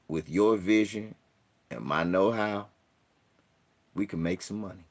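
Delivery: lightly voiced formal job-interview talk. Shown as a demonstration. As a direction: sound polished for a hiring manager. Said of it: "you speak job interview?".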